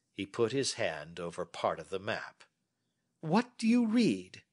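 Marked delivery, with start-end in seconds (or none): 3.2-4.4 higher, teasing question; Holmes quizzing. Acted. Holmes hiding part of the map, teasing question. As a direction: test your friend with a knowing prompt.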